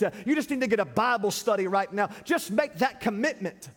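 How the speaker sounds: high, driving revival preacher; full-force preaching. Acted. Pastor retelling his street witness to church, full-force preaching. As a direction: preach it with rising fervor, pitch held high.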